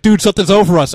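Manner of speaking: hammed-up scared cry; mock alarm. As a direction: imitate the brother freaking out. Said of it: "He's like".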